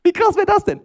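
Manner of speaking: very high, loud exclamation; mock amazement. Preacher imagines Jesus giving for profit, mock amazement. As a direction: blurt it out in exaggerated disbelief.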